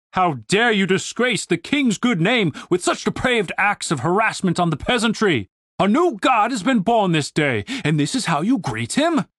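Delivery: indignant, pompous military officer; commander's ringing scolding; stern commander. Acted. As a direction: reprimand the men with grand outrage.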